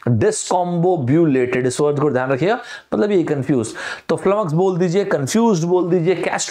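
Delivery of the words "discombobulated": discombobulated said again very slowly, syllable by syllable; slow repeat. Shown as a demonstration. Teacher repeating discombobulated slowly so students catch it. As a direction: drag discombobulated out slowly for the class. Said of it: "क्या बोला दोनों मैंने".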